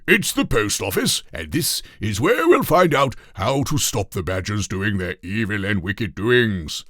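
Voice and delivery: king impatient with the trolley, loud. A narrator's reading. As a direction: explain it impatiently as if it were obvious. King voice continues.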